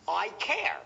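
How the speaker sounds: ordinary delivery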